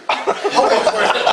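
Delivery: slow, loud, laughing. Real, not acted. Comic mocking the listener while laughing. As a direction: laugh through the punchline.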